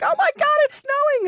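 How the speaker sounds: very high, excited squeal; mock high-pitched freak-out. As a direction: imitate yourself shrieking in amazement at snow. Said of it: "I was, like, freaking out".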